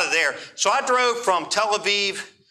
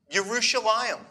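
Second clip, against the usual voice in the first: Jerusalem as Yerushalayim, higher imitated Hebrew accent; 4 semitones higher than usual. Girl saying where she lives, imitated Hebrew accent. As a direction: answer with the Hebrew-accented name of the city. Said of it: "she says with Hebrew accent".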